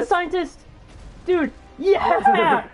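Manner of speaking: hyped shout with a long yeah; real whooping excitement; excited cheer. Real, not acted. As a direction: cheer the character's arrival. Cheering the scientist's appearance.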